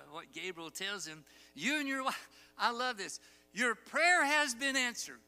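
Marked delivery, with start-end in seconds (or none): 1.5-5.2 angel's announcement. Acted. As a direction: proclaim it like a heavenly messenger. Preacher voicing angel Gabriel.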